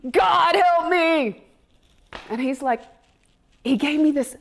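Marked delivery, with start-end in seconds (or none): 0.1-1.4 slow, drawn-out plea to God; slowed prayer, half-spoken; desperate plea. Retold or imitated. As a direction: beg for help under your breath. Pleading prayer after storming off.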